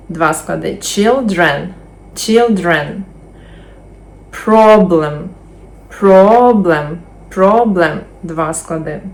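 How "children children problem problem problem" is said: slow, careful model words; first-syllable stress drill. Shown as a demonstration. Teacher drilling words for students to repeat. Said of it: "повторюємо за мною".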